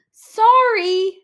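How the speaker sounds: high, loud, blurted apology; too much zing even saying sorry. A narrator's reading. Clark apologizes loudly.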